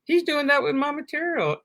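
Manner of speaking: high-pitched proud marveling; retold pride in a high voice. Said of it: "then I got proud, like".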